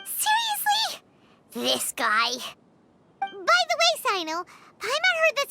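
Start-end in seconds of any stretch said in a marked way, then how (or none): 0.0-1.0 high squeaky incredulous question; exasperated disbelief. Acted. Little companion reacting to an ally's jibe, exasperated disbelief.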